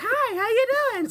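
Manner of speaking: mock the girly phone greeting; girl voice. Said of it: "you have to do girl voice".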